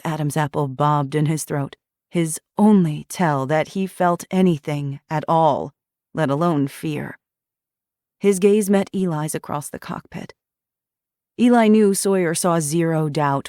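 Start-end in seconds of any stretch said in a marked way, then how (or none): none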